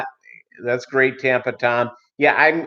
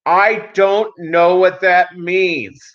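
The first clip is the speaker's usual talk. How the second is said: high, very slow, loud confused reply; mock yelling; 7 semitones higher, 4 dB louder, much slower than usual. Cashier yelling his confusion at the customer, slow and loud. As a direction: drag it out loudly.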